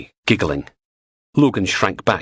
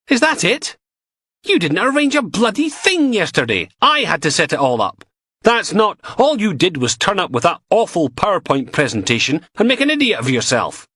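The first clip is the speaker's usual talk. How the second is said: angry retort; 12 semitones higher than usual. Acted. Logan shouts back.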